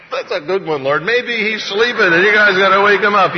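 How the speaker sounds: high, loud mocking taunt; gleeful sarcasm. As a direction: relish the joke, then yell it at them. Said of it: "sarcasm and cynicism in this".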